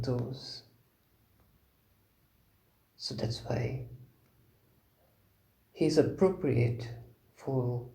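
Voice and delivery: sparse phrases with long, calm silences; guided meditation. Real, not acted. Teacher leading contemplation of Buddha's qualities, meditative.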